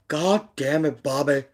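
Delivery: light impression of a cartoon dad; lightly put-on voice of an exasperated TV father; Hank Hill. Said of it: "I kinda sound like him".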